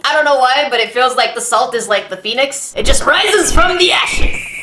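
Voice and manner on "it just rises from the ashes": slow, high, rising shout; dramatic proclamation. Acted. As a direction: proclaim it like a legend. Dramatic phoenix line.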